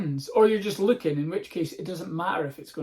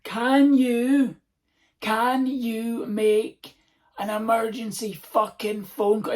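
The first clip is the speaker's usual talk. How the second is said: higher, louder, slow put-on demand; impatient put-on voice; pushy beggar; 5 semitones higher, 7 dB louder, much slower than usual. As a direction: lean on it like a rude stranger.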